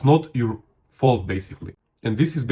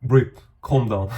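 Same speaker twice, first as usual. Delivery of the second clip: slow right down after rushing; unhurried coaching cue; calm, slow reset; slower than usual. Shown as a demonstration. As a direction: take a breath, slow and measured. Slows down after rushing demo.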